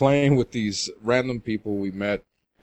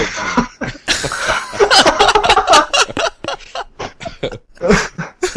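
long, high, helpless laughing fit; loud shrieking laughter; big laugh; 8 dB louder than usual. Real, not acted. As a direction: crack up completely at the story. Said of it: "Now we're all laughing".

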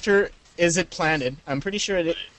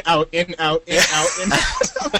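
ordinary talk, nothing marked

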